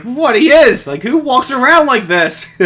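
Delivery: high, loud, mock-indignant disbelief; retelling his own defence to his wife, high and loud; incredulous protest. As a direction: defend yourself, half laughing. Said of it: "And I'm like".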